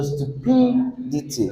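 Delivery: stu-PI-dity, PI high and long, then falling; soft, leaning on PI in "stupidity"; stress on second syllable. Shown as a demonstration. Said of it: "which one sounds more friendly".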